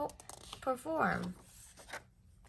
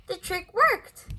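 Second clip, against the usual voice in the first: high, loud and slow with joy; delighted; 10 semitones higher, 8 dB louder than usual. A narrator's reading. Narrator delighting as the bear's trick works. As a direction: marvel that it worked.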